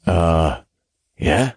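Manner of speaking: hesitant, questioning uh; puzzled, halting reply; confused. A narrator's reading. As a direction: answer unsure, rising at the end. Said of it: "completely confused".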